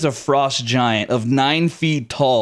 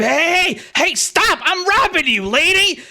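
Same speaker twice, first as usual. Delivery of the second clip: much higher, squeaky thug voice; squeaky little bandit. Acted. Four-foot bandit calls after the lady, squeaky high. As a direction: yap it out like a tiny mugger.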